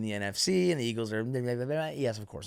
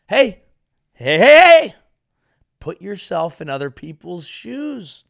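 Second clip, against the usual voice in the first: calling out; 5 semitones higher, 10 dB louder than usual. Pretend. Calling out to angry fans.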